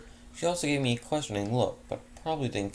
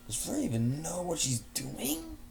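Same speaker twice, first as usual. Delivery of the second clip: incredulous; 8 semitones higher than usual. Acted. Narrator's incredulous inner thought.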